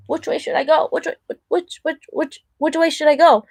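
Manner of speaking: high, repetitive, flustered dithering; mock freak-out. Imitating a caterpillar that can't pick a way, mock freaking out. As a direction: play-act someone stuck and unable to choose. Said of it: "you kinda, like, freak out".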